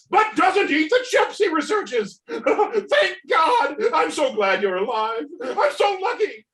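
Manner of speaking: manic ranting villain. Acted. Unmasked Ted raves at Dave Dickens, hysterical laughing rant. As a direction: rave in giddy hysterical relief.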